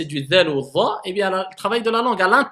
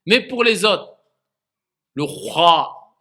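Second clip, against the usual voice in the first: ordinary delivery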